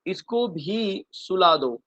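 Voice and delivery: "इसको भी सुला दो" said very slowly, word by word; very slow recital. Shown as a demonstration. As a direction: spell out the line slowly for the class. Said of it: "irritate कर रहा है कब से मुझे". ("इसको भी सुला दो" बहुत धीरे, शब्द-शब्द)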